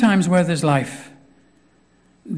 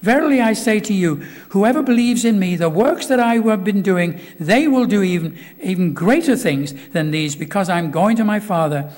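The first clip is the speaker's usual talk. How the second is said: raised, earnest preaching voice; 2 semitones higher, 4 dB louder than usual. Acted. Preacher urging the congregation, raised and earnest. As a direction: press the promise home to the congregation.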